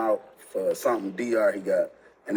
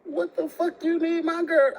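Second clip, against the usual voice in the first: much higher, half laughing in disbelief; stunned outburst. Retold or imitated. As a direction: squeak it out, laughing at the nerve of it. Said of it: "I was just".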